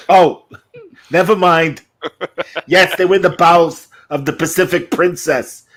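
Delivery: high, loud comic dealer; Gilbert Gottfried. Acted. Big Chicken admitting the boat scheme, Gottfried impression. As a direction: confess it in a high, put-on comic voice. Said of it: "they mentioned on Gilbert Gottfried".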